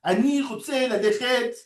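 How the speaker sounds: every word stressed at the end, like a French speaker; French-accented Hebrew. Shown as a demonstration. Teacher mimicking French speakers of Hebrew, French accent. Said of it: "French is a mil'ra language".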